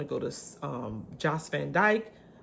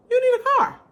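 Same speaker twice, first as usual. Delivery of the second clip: YOU need a car, much higher and louder; emphatic; 11 dB louder, faster than usual. Real, not acted. Telling visitors they need a car, loud emphatic stress. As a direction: lean hard on you, nearly shouting.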